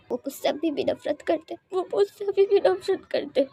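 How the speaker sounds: high, crying-voiced lament; tearful. Wing hugs Lanzan over being hated, tearful. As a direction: plead through tears, pitch climbing. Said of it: "wing को शांत करते हुए".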